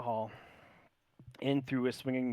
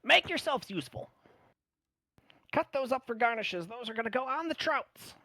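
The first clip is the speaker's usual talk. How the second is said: high, loud commanding chef voice; bossy gnome chef; 13 semitones higher, 4 dB louder than usual. Acted. Gnome chef to the party, high and bossy. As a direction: bark orders like a tiny head chef.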